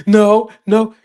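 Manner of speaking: mock tearful child's denial; crying child. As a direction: answer through tears like the son. Crying son answers dad.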